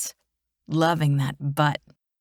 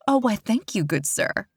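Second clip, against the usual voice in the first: mock regal lady; 3 semitones higher than usual. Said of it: "take his hand as if I'm regal".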